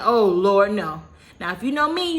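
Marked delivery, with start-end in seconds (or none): 0.0-1.0 anguished cry. Retold or imitated. Hearing dad goes to hospital.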